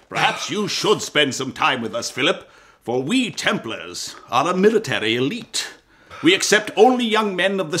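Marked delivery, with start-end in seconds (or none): none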